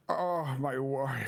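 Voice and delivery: low, slow, swooning lover; Gomez. Retold or imitated. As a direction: draw it out in deep adoration. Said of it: "I was saying it like Gomez".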